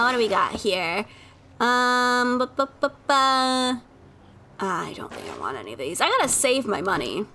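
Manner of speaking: ordinary talk, nothing marked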